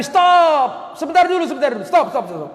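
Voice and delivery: high shouted halt, easing at the end; urgent acted hailing; calling out. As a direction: call passers-by to stop. Acting the Prophet halting two companions.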